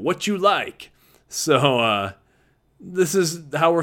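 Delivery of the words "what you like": high Texas coach catchphrase; light Texas drawl impression. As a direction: echo the coach's Texas accent, pitched up. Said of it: "He had that Texas drawl".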